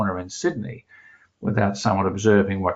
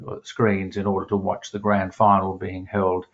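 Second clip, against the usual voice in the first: ordinary talk, nothing marked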